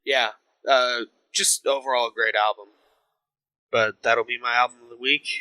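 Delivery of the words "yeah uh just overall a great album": ordinary talk, nothing marked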